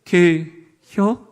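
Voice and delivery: very slow Chungcheong question; two stretched syllables, Chungcheong style; Chungcheong dialect. Shown as a demonstration. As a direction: ask it slowly like a Chungcheong local. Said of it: "우리 충청도에서는".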